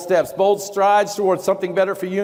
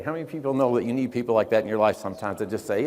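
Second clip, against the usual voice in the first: ordinary delivery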